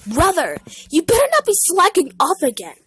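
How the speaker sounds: high, loud, fast scolding brother; bossy brother. Acted. Blackberry scolding his brother for slacking, high and loud. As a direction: nag him like a bossy big brother.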